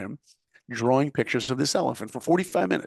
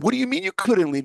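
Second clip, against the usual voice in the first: disbelief; 12 semitones higher, 4 dB louder than usual. Retold or imitated. Disbelief at classmates skipping drawing.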